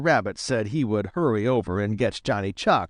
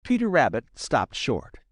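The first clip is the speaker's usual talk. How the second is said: usual speaking voice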